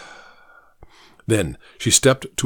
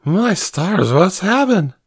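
startled; 7 semitones higher, slower than usual. Acted. Cook startled by alarm.

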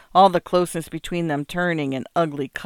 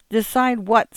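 higher, indignant retort; miffed, pitched-up question; in a huff; 6 semitones higher than usual. A narrator's reading. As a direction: throw it back huffily. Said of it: "right away in a huff".

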